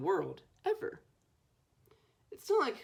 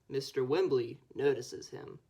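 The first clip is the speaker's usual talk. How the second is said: plain reading voice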